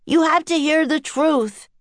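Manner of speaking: put-on high, insistent boy's yell; yelled plea. A narrator's reading. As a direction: call it out high to make them listen. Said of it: "Jack yelled in his loudest voice".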